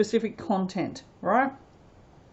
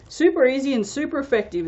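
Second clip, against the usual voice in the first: quick, upbeat catchphrase, a bit higher; Anthony's catchphrase; 5 semitones higher, 4 dB louder, faster than usual. Retold or imitated. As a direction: toss it off like a cheery slogan. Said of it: "as Anthony would like to say".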